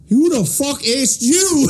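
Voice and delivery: very high, drawn-out mock yell; imitated angry outburst. Boss snapping at the wife butting in, imitated outburst. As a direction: explode in a high, stretched voice. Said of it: "And he just starts, like".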